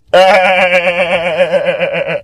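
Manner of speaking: drawn-out rolling laugh; long laugh. Real, not acted. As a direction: crack up at your own impression. Laughs at own impression.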